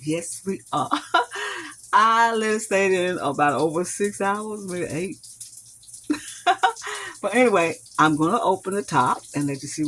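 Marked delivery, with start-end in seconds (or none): 0.9-1.7 short high-pitched giggle; a quick bright laugh at yourself. Real, not acted. Cook laughing at her own joke, a high giggle. 6.4-7.2 short high-pitched giggle; a quick bright laugh at yourself. Real, not acted. Cook laughing at her own joke, a high giggle. Laughing again mid-explanation.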